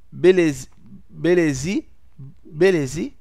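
"Belezi" low and loud, one isolated try after another; halting reading. Acted. New reader testing an unknown name, loud laboured reading. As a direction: sound out "Belezi" heavily, pausing between tries. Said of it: "seu estilo de leitura".

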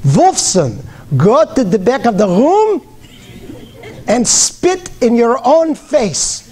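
put-on a touch of the old Russian-accented teacher, rising and slowing; red-faced teacher cursing a nine-year-old, lightly voiced; mock teacher yelling. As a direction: bark the curse like the old Hebrew teacher. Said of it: "he'd yell this instruction".

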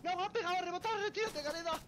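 high panicked shout to retreat; grenade warning yell. Real, not acted. Grenade bounces, teammate hit.

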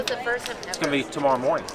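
normal talking voice

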